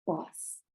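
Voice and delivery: very soft pause after a silence; demonstrated pause. Shown as a demonstration. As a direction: drop to near silence on pause. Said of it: "you want them to realize the impact".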